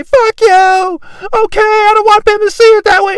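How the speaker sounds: high, loud mock tantrum; mock little girl. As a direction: mimic a petulant little girl storming off. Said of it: "act like some little girl".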